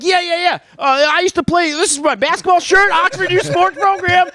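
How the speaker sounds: high, rushed eager pleading; frantic explaining. Retold or imitated. Suspect frantically explaining his basketball shirt to agents. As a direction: scramble to explain yourself.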